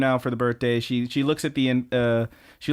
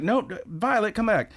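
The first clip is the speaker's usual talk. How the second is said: calling after her; 9 semitones higher than usual. Retold or imitated. Calling after departing friend.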